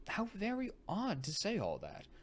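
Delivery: high, loud astonished exclaim; surprised outburst. A narrator's reading. Girl to her father, surprised and loud. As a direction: blurt it out in disbelief. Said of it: "I interpolated".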